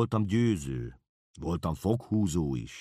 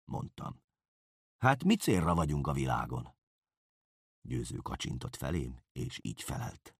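ordinary delivery, nothing marked

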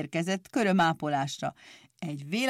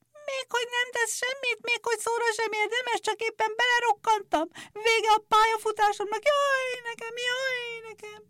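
a very high, thin squeal that turns into a wail; thin shrieking nail clippers. A narrator's reading. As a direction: squeal like tiny injured scissors, sobbing at the end. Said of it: "vékony hangon felvisított".

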